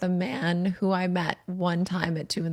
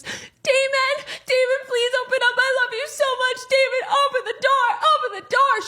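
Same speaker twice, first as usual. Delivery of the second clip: very high, loud, pleading imitation with a laugh breaking in; begging and crying; 4 dB louder than usual. Ex-girlfriend crying and begging Damon to open the door, mock falsetto. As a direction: play a sobbing woman pounding on the door, half-mocking. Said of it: "She's screaming, she's crying, she's begging".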